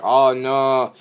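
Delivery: hammed-up annoyance; mock pissed-off cry. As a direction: imitate an angry pony's outcry. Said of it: "like, all pissed off".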